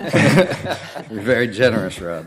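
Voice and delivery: laughing, slow and teasing; amused teasing remark. Real, not acted. Teasing after bike joke.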